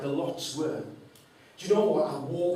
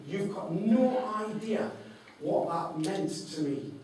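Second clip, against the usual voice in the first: usual speaking voice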